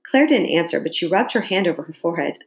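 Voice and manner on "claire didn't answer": usual speaking voice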